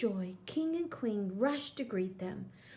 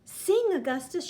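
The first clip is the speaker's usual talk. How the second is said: louder, higher call to sing; urgent cry; 7 semitones higher, 6 dB louder than usual. A narrator's reading. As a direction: order the prince to sing. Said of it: "she cries".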